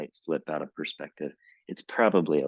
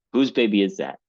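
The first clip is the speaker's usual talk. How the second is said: raised, higher voice for the angry father; yelling; 5 semitones higher, 5 dB louder than usual. Acted. Reading Dad's line in the fight, a raised yell. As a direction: throw the question at her like an accusation. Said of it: "Dad yelled".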